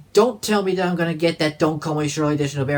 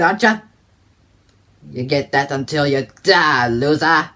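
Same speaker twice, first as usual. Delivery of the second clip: character voice; 4 dB louder than usual. Acted. Answering the audience's question in a loud character voice. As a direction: taunt him like a boisterous old laddie. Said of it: "what's this character's voice gonna sound like?".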